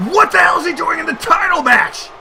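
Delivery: high, outraged question; incredulous shout. Real, not acted. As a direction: demand an explanation in disbelief. Outrage at surprise intruder.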